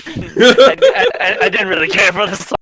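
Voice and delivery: high, loud laughing confession. Real, not acted. Co-host admits disliking the song, laughing.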